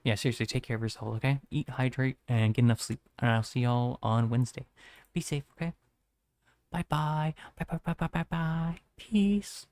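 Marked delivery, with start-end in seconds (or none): 5.1-9.7 high cutesy goodbye. Acted. Playful goodbyes to chat.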